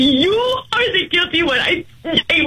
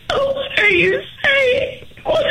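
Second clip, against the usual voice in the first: slow, tearful cry of disbelief; a stunned wife cries out as he ends it; crying plea; 8 semitones higher, slower than usual. Real, not acted. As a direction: sob the question out. Wife hears it's over.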